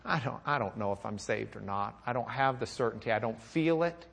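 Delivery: low, hushed confession of doubt; soft, low imagined prayer of uncertainty; voicing a doubter. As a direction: quietly voice someone unsure, starting very soft. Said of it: "where you just say".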